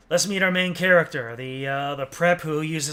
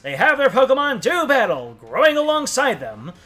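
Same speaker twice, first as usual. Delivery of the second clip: higher, formal news-anchor read; newscaster voice; 4 semitones higher than usual. Acted. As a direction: announce it like a TV anchor. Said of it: "so I'm using my newscaster voice".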